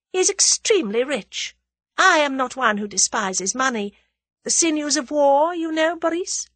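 high, confident lady villain; high-pitched, amused self-assurance; self-assured scheming woman. A narrator's reading. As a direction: play her poised and unworried.

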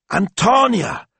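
high triumphant cry; crowing. Acted. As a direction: crow it out in delight. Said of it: "crowed Antonella".

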